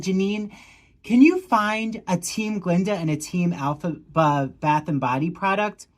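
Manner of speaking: normal talking voice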